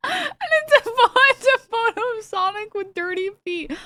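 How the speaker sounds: words squeezed out through high laughter; cracking up mid-sentence; laughing. Real, not acted. Laughing while describing the meme.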